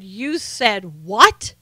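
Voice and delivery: slow, drawn-out mock threat; menacing Southern mother. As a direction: stretch it out like a mom demanding respect. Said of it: "woman turns around really quickly. She has a knife".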